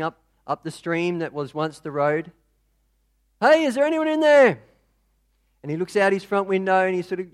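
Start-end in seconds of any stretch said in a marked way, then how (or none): 3.4-4.6 calling out. Acted. Boatmen calling to house.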